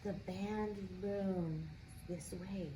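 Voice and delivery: ordinary delivery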